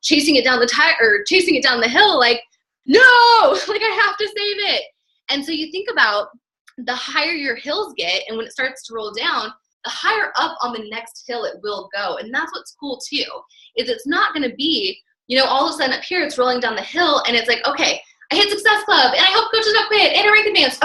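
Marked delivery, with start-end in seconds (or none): 2.9-4.8 hammed-up cry, high and loud, breaking into a laugh; playful alarm as the tire gets away; "No!" squealed high and loud, then laughing; mock panic. Chasing the tire down the hill. 17.6-20.8 bright rush of wins, pitched much higher; mock-excited checklist, voice climbing high; giddy. Imitating coach ticking off wins.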